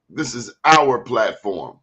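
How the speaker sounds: "our" slow and stressed, corrective. Shown as a demonstration. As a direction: lean hard on "our", slowly. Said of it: "there's a mistake".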